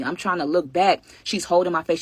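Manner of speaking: ordinary talk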